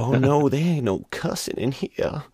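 lightly lampoon a pearl-clutching Southern belle; a touch of old-South propriety, mocking; mock antebellum Southerner. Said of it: "sound like some, like, antebellum person".

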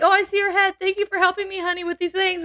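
high, sugary fake-innocent husband voice; mock sweet husband. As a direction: play the killer husband all sweet and innocent.

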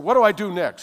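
high, loud eager question; eager shout. Retold or imitated. New believer asks Kirk Senior, eager loud question. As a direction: ask it bursting with eagerness.

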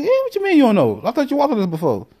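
high, fast disbelieving question; incredulous. Retold or imitated. To his date in the woods, high incredulous rush. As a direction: sound like you can't believe she doesn't know.

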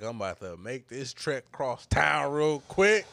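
loud, slow, drawn-out declaration; emphatic retold resolve. Man retelling his plan to cross town, loud and emphatic. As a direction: belt it out slowly for emphasis.

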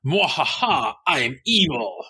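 hammy cartoon villain with a cackle; sneering panto villain. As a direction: ham it up as a one-note baddie. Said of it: "who aren't just a one-note".

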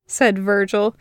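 plain reading voice

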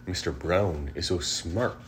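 ordinary delivery, nothing marked

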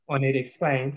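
ordinary delivery

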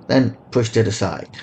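ordinary delivery, nothing marked

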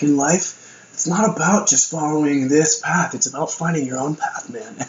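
lightly voiced wise guru. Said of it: "some gurus that are like".

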